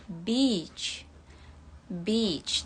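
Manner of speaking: "beach" with a long drawn-out ee vowel. Shown as a demonstration. Teacher showing the class a long ee in "beach". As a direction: stretch the ee in "beach", never short. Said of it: "Jadi agak panjang, nggak, mm, gitu. Jangan pendek ya".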